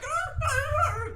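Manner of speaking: high yelping dog cries; very high yelping arr arr arr. Retold or imitated. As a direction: yelp like a hurt dog. Imitating dog yelps.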